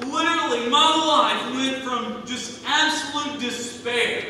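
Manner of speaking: ordinary talk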